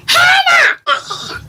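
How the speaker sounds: very high screeched yell; mock a teacher shrieking a pupil's name; piercing imitation of an angry yell; yelling gym teacher. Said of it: "my gym teacher yells".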